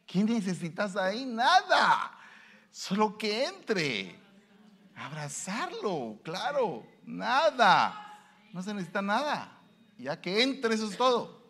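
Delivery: high, slow tender welcome; tender pastor. Acted. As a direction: embrace the sinner with the voice. Tender pastor welcoming weeping sinner. (pastor tierno, voz alta y lenta)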